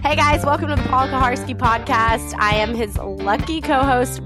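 ordinary talk

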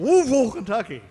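Louisville high and loud as "LOO-vul", then Kentucky; insistent local pronunciation. Shown as a demonstration. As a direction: hammer Louisville as two-syllable "LOO-vul". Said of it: "That's how they say it".

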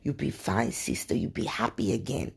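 put-on soft, fading African accent almost whispered; hushed African blessing. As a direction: murmur a comforting promise in an African lilt. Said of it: "She said".

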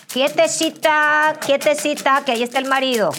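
loud, high, hammed-up command to behave; playful high-pitched telling-off; mock self-scolding.

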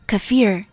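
"kefir" as kuh-FEER, falling at the end; correct pronunciation. Shown as a demonstration. Teacher listing variants, second "kefir" as kuh-FEER. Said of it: "however you pronounce it".

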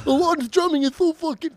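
mocking gushing fan. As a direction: parody the friend raving about drums. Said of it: "you're gonna fucking gush over".

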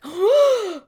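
high startled gasp; a sudden frightened intake of breath; sharp gasp. Retold or imitated. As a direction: gasp as if you just saw a figure in the dark. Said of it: "like, terrified".

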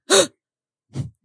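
retold gasp. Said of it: "And I was like".

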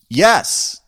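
high, loud reply across a street; calling back. Retold or imitated. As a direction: re-enact shouting back to a distant stranger. Answering the man across the street.

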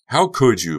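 high, loud outraged yell; straining scream. A narrator's reading. Becca to Honey backing away, straining scream. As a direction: scream the accusation. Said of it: "Becca screamed, straining her throat".

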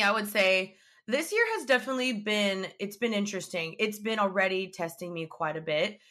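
ordinary talk

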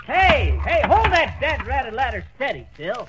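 shouted order from up a ladder; calling up. Acted. McGee shouting from the ladder to Phil. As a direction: call down the ladder loud and urgent.

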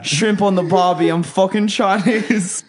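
hammed-up Australian accent; mock Aussie accent. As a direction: lay on the Aussie bloke accent. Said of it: "British halfway through".

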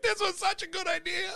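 high, strained mock voice; squeaky mock lament; mock regretful quote. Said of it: "you're like".

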